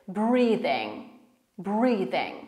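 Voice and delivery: "Breathing" halted just before the g; silent final G. Shown as a demonstration. Teacher stops before saying the g, "Breathing" ending in plain ng. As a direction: end "Breathing" on a held ng, never a hard g. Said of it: "wypowiedzeniem tego g".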